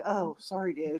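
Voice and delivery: small, soft, imitated apology; sheepish apology. Co-hosts apologizing for laughing at him, quietly sheepish.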